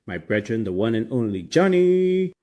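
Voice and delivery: ordinary talk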